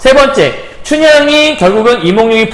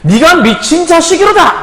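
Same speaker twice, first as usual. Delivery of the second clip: higher, slow, scornful line with weight on 자식이로다; mock anger; 8 semitones higher than usual. Chunhyang snapping back at the servant, mildly angry. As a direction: act out an indignant put-down, a touch angry. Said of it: "춘향이 화를 내요".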